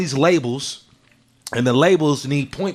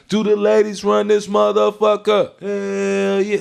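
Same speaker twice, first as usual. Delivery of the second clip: raised, drawn-out crowd call on a held pitch; hype man; 5 semitones higher, slower than usual. Retold or imitated. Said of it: "when I was his hype man".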